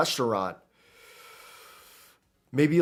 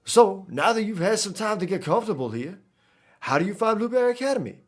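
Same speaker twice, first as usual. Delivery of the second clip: higher, lilting attempt at a Creole accent; animated Creole-accented character, pitched up; Cyrano accent; 7 semitones higher than usual. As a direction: voice a game character in a put-on New Orleans accent. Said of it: "pull out the Cyrano accent".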